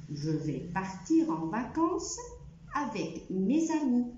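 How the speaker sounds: very slow, word by word; slow French reading. Shown as a demonstration. Teacher repeating the French sentence slowly. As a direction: spell out the sentence slowly for learners. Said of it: "يبقى أنا هنطقها تاني".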